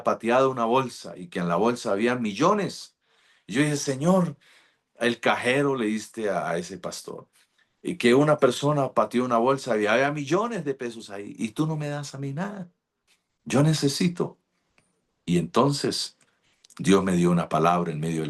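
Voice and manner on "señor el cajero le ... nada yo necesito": higher, aggrieved plea; indignant complaint to God. Retold or imitated. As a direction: protest it like a wronged son.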